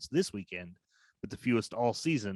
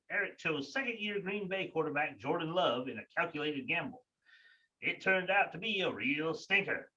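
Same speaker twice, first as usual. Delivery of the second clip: higher echoey sewer reporter; echoing tunnel; 6 semitones higher than usual. Acted. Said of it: "coming to you live from the sewer".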